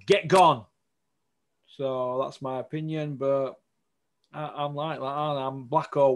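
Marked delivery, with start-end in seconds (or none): 0.1-0.7 angry send-off. Real, not acted. Host tells boxing officials to go.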